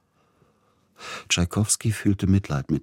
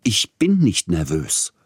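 higher, slow, emphatic denial; snapping angrily; 4 semitones higher, 4 dB louder than usual. A narrator's reading. Composer contradicting the agent's reproach, snapping angrily. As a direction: lash out, stressing each word. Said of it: "fuhr Peter Iljitsch ihn an".

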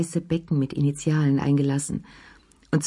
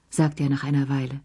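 usual speaking voice

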